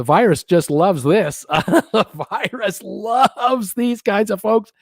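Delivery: mock delight, chuckling as you say it; amused ironic laugh while talking; sarcastic chuckle. Real, not acted. Gleeful sarcasm about the virus.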